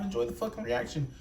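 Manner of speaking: ordinary talk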